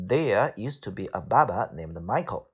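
British English, barber without r's; British pronunciation. Shown as a demonstration. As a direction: say it British, dropping the r in There and barber. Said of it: "这就是英式发音".

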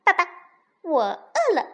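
high, insistent chirp; hungry duckling. Acted. Duckling climbs out hungry, high insistent plea to daddy. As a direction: plead loudly like a baby duck. Duckling begs food again.

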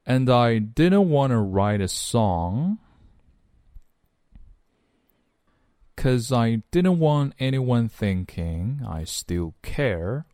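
slow, drawn-out phrases with clear linking; follow-along model reading. Shown as a demonstration. Teacher leading learners through the lyric, slow model reading. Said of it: "来跟着[name]试一下".

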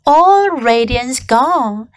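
ordinary delivery, nothing marked

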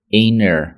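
inter said as inner, t dropped; American inner; flapped inner. Shown as a demonstration. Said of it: "就是inner".

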